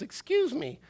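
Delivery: put-on very high, little toddler voice; high-pitched cheeky kid impression; imitated small boy. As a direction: play a prim, affronted little boy. Toddler's cheeky reply to father.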